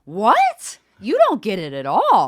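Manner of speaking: loud, high, exasperated outburst; mad. Retold or imitated. Daughter losing it at her dad, loud anger. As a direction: explode at someone who won't understand. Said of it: "I was so mad".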